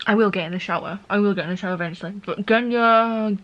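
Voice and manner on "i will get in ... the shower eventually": fast, lower, under the breath; tossed off quickly, almost mumbled; rushed aside. Real, not acted. Vlogger promising viewers a shower, fast and low.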